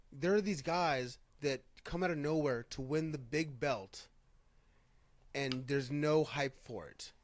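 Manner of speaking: ordinary talk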